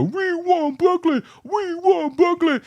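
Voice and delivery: high-pitched drawn-out fan chant; imitated crowd yell, high and stretched out; mock crowd screaming. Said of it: "everybody would start screaming".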